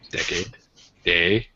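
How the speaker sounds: ordinary talk, nothing marked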